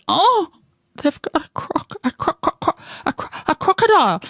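high, terrified stammer; stammering in fright. A narrator's reading. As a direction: stutter over the word in terror. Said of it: "He stammered".

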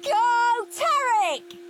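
high, loud excited cheer. A narrator's reading. Henry cheering on Tornado Tarek on TV, loud high cheer. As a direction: shout it like a fan at the telly. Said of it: "cheered Henry".

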